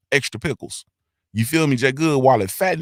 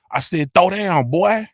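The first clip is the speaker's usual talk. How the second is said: imitating old gangster; 6 semitones higher, slower than usual. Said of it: "he call it throw down".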